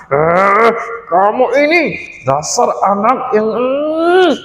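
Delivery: mild mock anger at the little brother; angry big sister. Sister scolding her brother for breaking mother's necklace, lightly angry. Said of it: "si Helen begitu marah".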